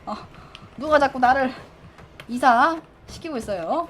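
ordinary talk

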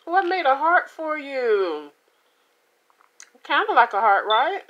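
high, loud, thrilled exclamation; delighted. Real, not acted. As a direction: squeal with delight at the shape. Delighted showing bitten heart shape.

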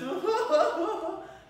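high, loud evil cackle; hammed-up wicked laugh; villain laugh. Mouse King cackles over the beaten prince, evil cackle. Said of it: "he said, laughing".

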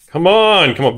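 high, eager cry of come on; urging the pack on, real excitement; excited. Real, not acted. Pack opening, hoping for hit.

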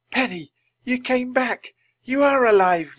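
high, loud joyful amazement; amazed, supposedly whispered. Acted. Teddy sees Penny alive, amazed and joyful. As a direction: exclaim in overjoyed disbelief, fully voiced. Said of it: "whispered Teddy".

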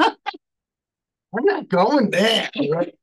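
slow, high, loud mock refusal; playful dodge. Real, not acted. Host jokingly backs off the neighbors' two copies, playful dodge. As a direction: draw it out high, teasingly refusing to comment. Teasing after the neighbors story.

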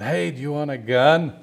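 wife calling to a guest about a bear, mild mock yell; retold yell. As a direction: call out the offer as if from a distance. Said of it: "yells to the husband".

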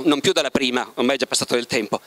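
ordinary talk, nothing marked

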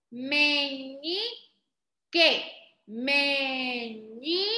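"meñique" very slow, ME-ÑIII... KE; slow rising "meñi", then separate "que"; syllable by syllable. Shown as a demonstration. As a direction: stretch "meñique", pause before KE. Teacher sounds out word by syllables.

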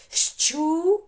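Щука high and loud, ш and ч both clearly heard. Shown as a demonstration. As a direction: punch out «Щу-ка» in syllables. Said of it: "голосно звучали і ш, і ч". («Щу-ка» голосно, з чіткими ш і ч)

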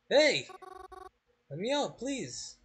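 loud, high cry for help, slowing on the plea; panicked shout. Acted. Trapped in a coffin, begging Reginald, panicked shout. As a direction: yell out, begging to be freed.

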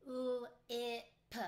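g-r-ate said sound by sound, slowly, each sound apart; chopped into sounds. Shown as a demonstration. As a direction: break g-r-ate into its separate sounds for the class. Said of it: "I'm going to chop it out loud".

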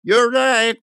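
high, loud agreement; agreeing tavern man. A narrator's reading. Another drinker backing the fat man, emphatic. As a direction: chime in emphatically.

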